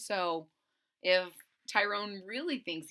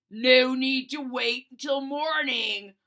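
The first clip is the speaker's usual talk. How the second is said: sinister voice; 4 semitones higher, 9 dB louder than usual. Acted. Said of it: "said a sinister voice".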